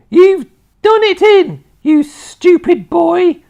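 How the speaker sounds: high screeching scolding from Grandma; loud, piercing old-woman scolding; angry Grandma shout. A narrator's reading. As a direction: shout it down at the boy, drawn out and very high. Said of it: "Grandma shouted".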